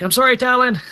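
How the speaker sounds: put-on boy's apology. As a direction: yelp it like a scared young halfling. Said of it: "he runs, like".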